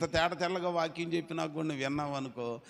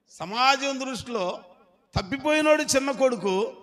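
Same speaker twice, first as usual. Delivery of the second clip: preaching shout; 12 semitones higher, 10 dB louder than usual. Acted. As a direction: proclaim it to the congregation. Preaching at full force. (గట్టిగా ప్రసంగం)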